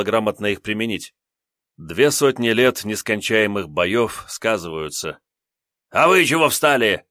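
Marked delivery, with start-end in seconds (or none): 5.9-7.0 a barked order to lackeys. Acted. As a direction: bark at the lackeys. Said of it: "рыкнул он на подпевал". (рявкает на подпевал)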